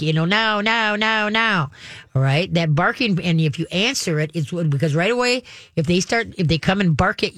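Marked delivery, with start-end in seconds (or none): 0.2-1.7 put-on higher, insistent childish nagging; whiny kid. Trainer imitating a kid yanking on a parent, whiny and pushy. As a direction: tug at a parent with a whining voice. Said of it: "a kid yanking on you like".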